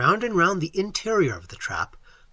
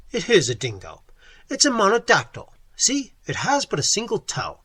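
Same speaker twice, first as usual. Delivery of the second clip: firmly; 5 semitones higher, 3 dB louder than usual. A narrator's reading. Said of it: "I said firmly".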